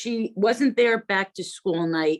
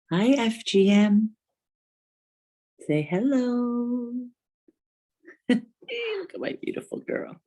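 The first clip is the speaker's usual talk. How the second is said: talking to a dog; 4 semitones higher than usual. Real, not acted. Coos to her dog Lucy.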